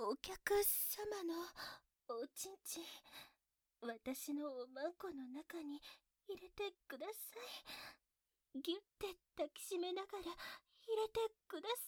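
very soft, slow begging; shy pleading. Acted. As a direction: whisper the plea shyly. (恥ずかしそうにささやく)

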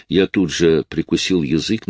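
usual speaking voice

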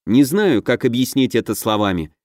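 plain reading voice

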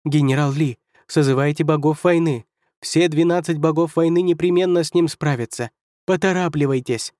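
high-pitched frantic order; urgent yelling. Acted. First counselor ordering General Li to summon war gods, yelling. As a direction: call out the order in alarm.

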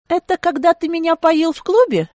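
high, teasing question; openly amused. A narrator's reading. Woman teasing the sulking man about the club, amused. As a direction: tease him, pitched well up. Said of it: "Теперь я уже откровенно смеялась".

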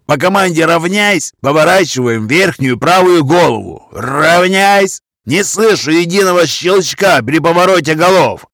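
high, loud parade-ground bellow; shouting drill sergeant. Acted. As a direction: bark the orders at the squad. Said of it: "Поорав как следует".

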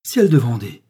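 sudden loud oath of astonishment; startled exclamation. A narrator's reading. Pharmacist reading the letter, blurts out in loud surprise. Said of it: "fit-il tout à coup en tombant assis".

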